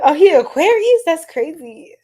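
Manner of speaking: high, loud amused disbelief; laughing realization; shocked and giggling; surprised laugh. Real, not acted. Surprised by chat comment.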